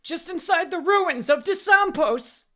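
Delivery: high, loud, frantic cry. A narrator's reading. Man confessing where he found it, crying out. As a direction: blurt it out in terror. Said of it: "the man cried".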